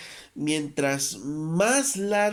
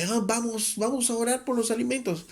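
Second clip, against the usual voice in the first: a hint of a higher, hurried church brother; lightly voiced eager brother; 9 semitones higher than usual. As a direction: mildly mimic a brother rushing to grace. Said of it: "Hay hermanos que".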